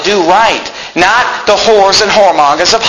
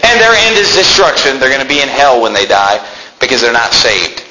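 usual speaking voice

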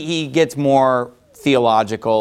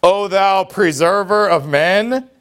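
slow, drawn-out mocking title; mock reverence ending on a questioning rise; sarcastic; 3 semitones higher, slower than usual. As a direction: stretch it out with sarcasm. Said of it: "he's using it as a sarcastic".

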